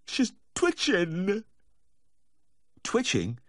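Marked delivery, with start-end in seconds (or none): 0.1-1.5 high, drawn-out tearful wail; weepy high-pitched lament; distraught weeping. A narrator's reading. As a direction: wail it slowly, close to sobbing. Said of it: "the distraught weeping".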